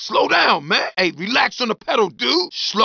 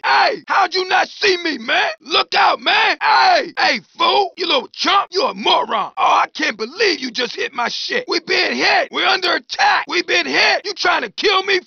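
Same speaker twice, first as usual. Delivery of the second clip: angry yelling; 7 semitones higher than usual. Acted. Pedestrian yelling at the driver who hit him, outraged shout. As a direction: yell at the fool who hit you.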